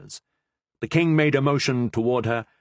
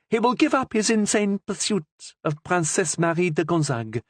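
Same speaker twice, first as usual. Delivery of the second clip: higher, lightly voiced queen mother, coaxing; queen mother's voice; 8 semitones higher than usual. A narrator's reading. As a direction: plead as the king's mother, a little higher.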